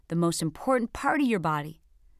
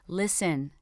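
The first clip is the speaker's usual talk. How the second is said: ordinary talk, nothing marked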